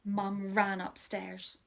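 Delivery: usual speaking voice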